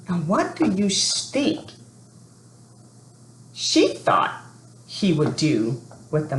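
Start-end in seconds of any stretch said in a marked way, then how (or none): none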